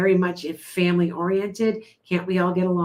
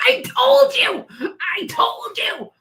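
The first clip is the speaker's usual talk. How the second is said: high, squealing told-you-so through laughter; real laughing vindication, pitched way up; gleeful triumph; 13 semitones higher, 4 dB louder, slower than usual. Real, not acted. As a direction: crow it in a high voice, cracking up. Gleeful when her guess proves right.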